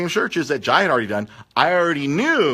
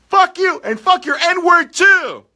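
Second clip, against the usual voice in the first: much higher, louder and slow, a spat-out curse; angry customer curse; 5 dB louder, much slower than usual. Retold or imitated. Leaving customer curses the manager, high and loud. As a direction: curse each word out slowly. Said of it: "He's like".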